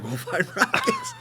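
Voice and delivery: squeaky laugh through the words. Retold or imitated. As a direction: crack up while saying it. Laughing while quoting himself.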